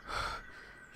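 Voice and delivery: breathy, exhausted sigh; tired sigh. Acted. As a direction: let out a weary sigh. Tired engine sighs.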